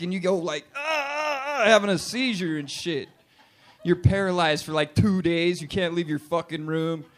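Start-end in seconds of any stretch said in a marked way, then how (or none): 0.7-1.6 high, halting cry of pain; mock pain cry. Foot hits the floor after sleep injury, acted high pain cry. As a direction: yelp as if pain shoots up the leg. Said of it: "sharp pain starts shooting up".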